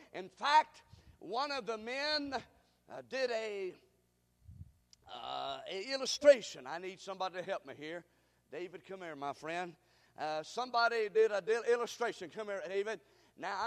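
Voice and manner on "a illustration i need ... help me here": ordinary talk, nothing marked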